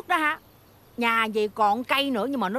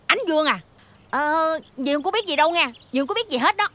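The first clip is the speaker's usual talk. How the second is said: nervous denial; 4 semitones higher than usual. Acted. Aunt nervously telling Ánh Dương she knows nothing, flustered denial. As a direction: protest innocence a bit too eagerly.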